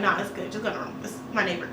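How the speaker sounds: soft, low quick reassurance; calm him down quietly; hushed reassurance. Retold or imitated.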